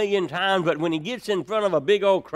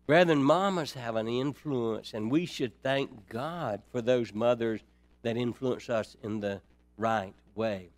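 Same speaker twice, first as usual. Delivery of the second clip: usual speaking voice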